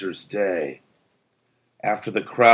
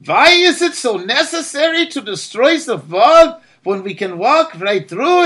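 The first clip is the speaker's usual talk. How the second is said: put-on high, loud voice with a German accent; a puzzled German asking why; German-accented citizen; 12 semitones higher, 4 dB louder than usual. A narrator's reading. German citizen questioning Absil in a German accent. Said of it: "a German citizen approached him".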